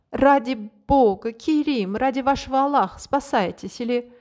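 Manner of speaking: high loud frantic shout. A narrator's reading. The princess shouts to Kerim to flee. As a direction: cry out to save him. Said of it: "крича".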